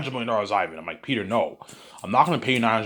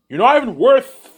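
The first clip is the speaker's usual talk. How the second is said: angry outburst; 10 semitones higher, 9 dB louder than usual. Acted. Ivan berates Peter angrily.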